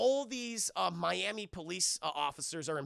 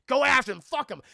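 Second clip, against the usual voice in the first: shouted order; 6 semitones higher, 13 dB louder than usual. Retold or imitated. Retold chief barking orders.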